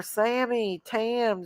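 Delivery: ordinary talk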